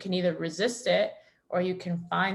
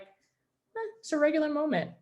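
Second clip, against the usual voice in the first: shrugging indifference; 9 semitones higher than usual. Retold or imitated. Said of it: "moments of like".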